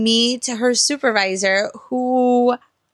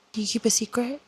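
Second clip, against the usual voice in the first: hushed read; 2 semitones higher, 7 dB quieter, faster than usual. Real, not acted. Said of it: "reading the line aloud".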